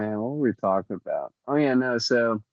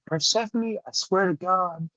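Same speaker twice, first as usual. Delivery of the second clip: mock yell at the cat; 8 semitones higher, 3 dB quieter than usual. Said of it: "I was yelling at her".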